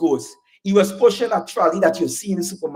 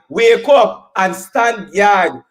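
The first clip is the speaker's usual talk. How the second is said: slow emphatic repeat; much slower than usual. Acted. Rally speaker repeats slowly.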